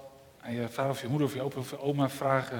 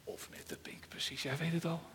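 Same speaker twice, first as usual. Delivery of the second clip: voiceless secret aside; whisper; 12 dB quieter than usual. Acted. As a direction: whisper to the children conspiratorially. Storyteller's hushed aside. (fluisterend terzijde tegen de kinderen)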